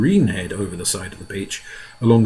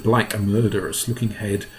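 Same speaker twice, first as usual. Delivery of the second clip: ordinary delivery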